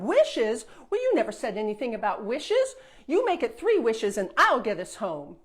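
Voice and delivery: loud, eager princess, fast in the middle; snatch at the wishes; greedy princess. Acted. Princess bargaining with the fairy for three wishes, loud and eager.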